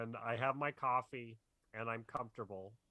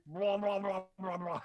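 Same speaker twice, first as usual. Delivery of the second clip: imitated alien noise; 7 semitones higher than usual. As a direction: blurt nonsense syllables like a walrus-faced alien. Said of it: "But he goes".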